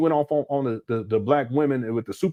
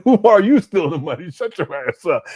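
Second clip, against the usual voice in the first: louder, laughing mock comeback; laughing retort; 4 semitones higher, 6 dB louder than usual. Answering Umar's contractor excuse with a laughing retort. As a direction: fire it back through a laugh. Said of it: "I said".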